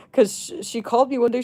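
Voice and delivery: ordinary delivery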